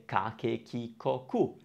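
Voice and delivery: "ka, ke, ki, ko, ku" low and even; K-syllable drill. Shown as a demonstration. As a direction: recite "ka, ke, ki, ko, ku" softly with a k sound. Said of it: "the k sound".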